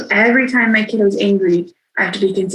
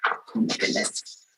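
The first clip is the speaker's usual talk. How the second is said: slow, breath-only aside, very soft; hushed disbelief; 2 semitones higher, 11 dB quieter, slower than usual. Retold or imitated. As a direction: let it out under your breath, recalling the shock. Recalling her shock at groceries.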